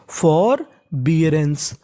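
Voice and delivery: फॉर बियरेंस slowly, in two separate parts; teacher's slow split of फॉर बियरेंस; sounded out. Shown as a demonstration. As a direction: draw out फॉर, pause, then बियरेंस.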